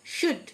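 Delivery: "শুড" with the L left unsounded; silent L. Shown as a demonstration. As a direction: say "শুড" with no L before the D. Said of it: "এল silent". ("শুড", এল উচ্চারণ না করে)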